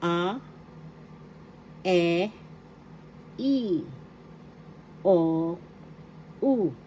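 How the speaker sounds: "a, e, i, o, u" one by one with long pauses; teacher modelling Tagalog "a, e, i, o, u"; vowel pronunciation demo. Shown as a demonstration. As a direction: sound out each of "a, e, i, o, u" separately. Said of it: "it is pronounced as".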